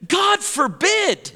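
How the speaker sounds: slow, emphatic God forbid; Paul's emphatic reply. Retold or imitated. Paul answering his critics, slow and emphatic. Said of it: "And Paul said".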